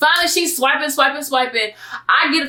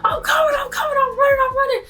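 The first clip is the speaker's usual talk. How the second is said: much higher frantic shout; frantic reply; faster than usual. Retold or imitated. Narrator answering grandma mid-sprint, high frantic shout. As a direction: yell it high while out of breath. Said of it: "I'm like".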